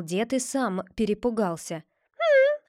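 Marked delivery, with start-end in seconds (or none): 2.2-2.6 high squeaky beak hum. A narrator's reading. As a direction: squeak a tiny hm. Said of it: "пропищал нос".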